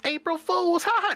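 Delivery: high, loud gleeful gotcha; hammed-up triumphant laugh; mock April Fools. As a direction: crow it like a prankster. Mock prank reveal.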